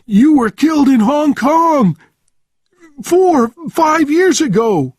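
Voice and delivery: very high, loud hysteria; shrieking with terror, voice pushed right up; panicked screaming. A narrator's reading. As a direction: scream it in wild disbelief. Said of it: "screaming, his eyes wide in panic".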